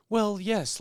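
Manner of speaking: mock official reply. Cohost mocking an ideal government spokesperson, high and prim. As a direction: put on a fussy polite official.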